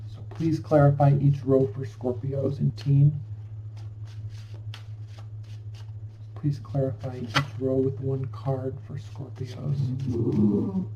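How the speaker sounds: soft, even-pitched request to spirit; prayerful invocation. Real, not acted. Asks spirit to clarify cards.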